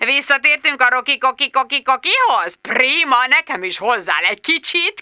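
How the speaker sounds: high, gleeful mocking phone voice; chuckling boss. A narrator's reading. Boss teasing employee who is at the police, chuckling mockery. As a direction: tease in a high, giggly singsong.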